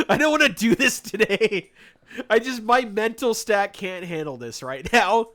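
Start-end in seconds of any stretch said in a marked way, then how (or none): 0.1-1.7 real laugh through the words; giggling it out, louder and higher; amused laughing complaint. Real, not acted. Laughing at his own dread.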